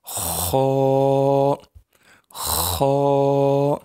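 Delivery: خا with rasped 'kh' and rounded back 'aw' vowel; thick, heavy kha. Shown as a demonstration. Teacher modelling a heavy letter, thickened خا. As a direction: hold خا full and thick. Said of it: "تھوڑا سا موٹا کر کے پڑھنا ہے".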